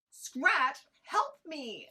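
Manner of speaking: high, loud desperate cry; calling for help. Acted. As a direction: yell for help hanging from a cliff. Dangling from a cliff, calling out.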